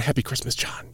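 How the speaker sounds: soft whispered wish; whispering. Shown as a demonstration. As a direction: whisper it softly. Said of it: "'cause that doesn't work".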